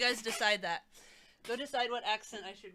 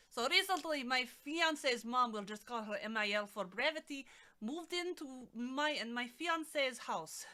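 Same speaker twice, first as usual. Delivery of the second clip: Russian accent; 3 semitones higher, 6 dB louder than usual. Shown as a demonstration. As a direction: declaim it in an exaggerated Russian accent, nearly yelling. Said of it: "All right, we'll try to do Russian".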